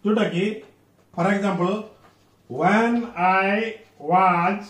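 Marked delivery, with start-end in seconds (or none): 2.5-4.6 when I was, very slow and left rising; slow demo of an incomplete when I was. Shown as a demonstration. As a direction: stretch when I was, lifting as if unfinished. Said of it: "rising stone ପାଇଁ incomplete".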